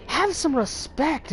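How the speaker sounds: high, slow mock-scolding teacher; hammed-up prim rebuke; stern teacher. Teacher scolding the class clown, slow and prim.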